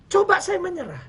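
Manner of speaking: high, forceful what-if; emphatic cry. Real, not acted. Preacher warning the congregation what giving up would cost, high and forceful. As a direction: fling the words up high.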